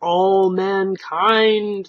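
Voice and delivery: very slow, solemn start; slow, monotonous golem pronouncement; grand golem opening. Acted. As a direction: intone it slowly. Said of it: "the stranger began".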